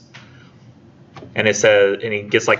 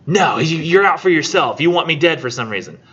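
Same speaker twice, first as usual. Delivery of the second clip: higher, heated accusation; accusing king; 6 semitones higher than usual. Retold or imitated. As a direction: hurl the blame at the prophet. Oedipus accuses the prophet.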